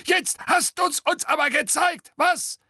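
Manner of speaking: very high, loud jeering shout; mock the boy's word in a shrill shriek; shrill mocking gargoyle. A narrator's reading. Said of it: "mit schriller Stimme". (schriller, höhnischer Wasserspeier)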